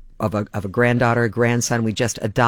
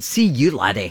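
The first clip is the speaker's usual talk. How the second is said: put-on Scottish accent. Radio host shows off his Scottish accent after the Scottish Fantasy. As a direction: toss it off like a cheeky Glaswegian farewell. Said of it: "you come by that accent authentically".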